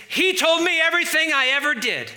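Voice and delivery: high, loud, thrilled outburst; overjoyed convert shouting her news; excited testimony. Acted. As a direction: blurt it out like she can't contain it. Acting out the Samaritan woman.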